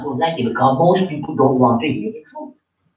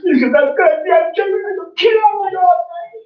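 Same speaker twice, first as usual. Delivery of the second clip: very high acted wail; tearful pleading; 14 semitones higher than usual. Pleading inside a retold confrontation.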